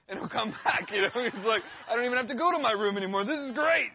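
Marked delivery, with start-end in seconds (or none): 1.9-3.9 put-on high, loud, rushed kid voice; imitated excited child. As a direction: mimic a kid crowing triumphantly. Imitates son's gleeful reply.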